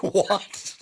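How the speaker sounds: high, loud, laughing "what"; incredulous. Retold or imitated. Replying to a stranger's mistake about Canada, incredulous. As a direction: blurt it out in disbelief.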